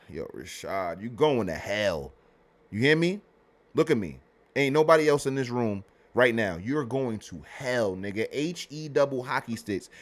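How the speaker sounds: quiet, measured mock threat; mock-stern warning. Streamer privately condemning Rashad to hell, mock-stern.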